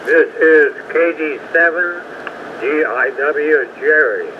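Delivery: ordinary talk, nothing marked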